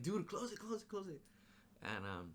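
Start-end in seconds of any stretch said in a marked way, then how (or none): 0.0-1.2 frantic urging. Retold or imitated. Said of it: "And she's like".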